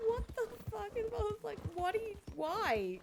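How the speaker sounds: very high-pitched incredulous imitation; mock bewilderment. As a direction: squeal it in exaggerated disbelief. Re-enacts bewildered reaction after laughing.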